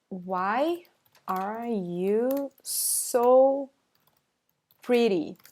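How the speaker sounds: very slow, drawn out with pauses; word by word. Shown as a demonstration. Teacher translating for learners, slow word by word. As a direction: stretch each word, pausing between them.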